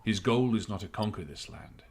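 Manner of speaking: lightly voiced captain, calm and grave; understated officer with grave knowledge; measured captain. Game master voices the captain answering.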